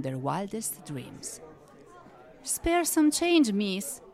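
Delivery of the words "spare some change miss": high, slow, mildly hopeful plea; tinge of hopefulness. A narrator's reading. Poor woman begging for change, a touch hopeful. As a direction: ask high and drawn out, with a little hope. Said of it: "her voice tinged with hopefulness".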